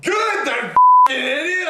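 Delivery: loud, slow scornful yell; angry shout. Real, not acted. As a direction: shout it out in contempt. Hears Christopher died.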